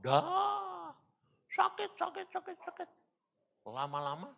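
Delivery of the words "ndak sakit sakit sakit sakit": high, strained cry of pain; desperate pleading in pain. Retold or imitated. Crying out in pain as dying.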